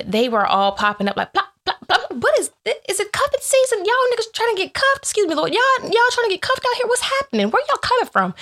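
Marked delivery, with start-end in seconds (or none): 1.3-2.1 high mock plop plop noises; popping sounds. Mimicking phones popping up. 2.1-8.3 much higher, mock-baffled questions to the guys; mock surprise. Mock talking to sudden suitors.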